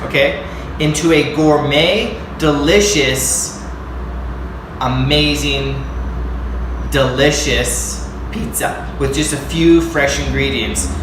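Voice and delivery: loud, punchy TV-host intro, slow at the start; hyped vlog intro. Acted. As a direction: sell it like a cooking show host.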